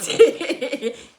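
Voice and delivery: a short real burst of laughter; amused laugh at a quirky Igbo expression. Real, not acted. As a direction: laugh out loud at a strange idea. Laughing at odd word logic.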